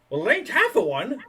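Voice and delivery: put-on high, wry old sea dog; high old sailor voice. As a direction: raise pitch, deadpan sailor. NPC punchline, high voice.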